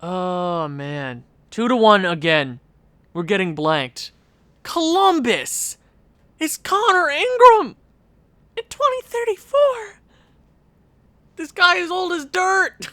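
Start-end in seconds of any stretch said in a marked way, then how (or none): 4.6-12.9 much higher, stretched, mock-amazed; incredulous mocking shouts. Real, not acted. Mocking opponent goalie.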